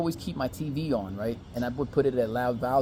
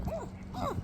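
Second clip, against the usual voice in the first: tiny high, soft imitated moans; mock stifled moans; 13 dB quieter than usual. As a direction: hold the mock moans in, closed-mouthed. Imitating her held-in moans.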